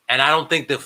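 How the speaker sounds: ordinary talk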